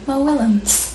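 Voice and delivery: ordinary delivery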